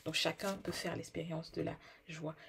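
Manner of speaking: usual speaking voice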